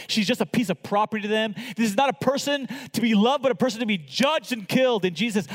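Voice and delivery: ordinary delivery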